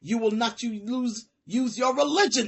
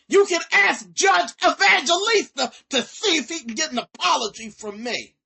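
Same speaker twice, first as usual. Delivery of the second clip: higher and louder, yelled in defiance; angry shout; 6 semitones higher, 6 dB louder than usual. Real, not acted. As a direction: shout that you meant every word.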